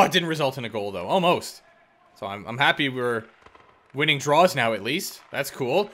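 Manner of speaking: usual speaking voice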